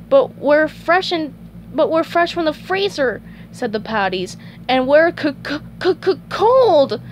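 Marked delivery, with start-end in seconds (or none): 0.1-3.2 high little storybook voices; patty characters. Acted. Frozen patties in the grill's story, high piping voice. 4.7-7.0 high, loud stuttering shiver; shivering patties. Acted. Patties fresh from the freezer, high chattering stutter.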